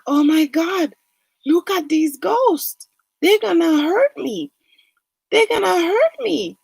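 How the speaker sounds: high, panicky retelling of a man seeing ghosts; scared of ghosts. Retold or imitated. Man facing ghosts, voiced high and frightened. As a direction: voice him shooting up high in fright.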